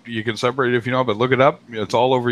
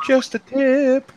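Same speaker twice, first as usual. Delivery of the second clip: slow, much higher mock-wise tone; exaggerated knowing aside, slow and high; knowing tip; much slower than usual. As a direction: drawl it out high like a sly hint. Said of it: "I looked at him and I went".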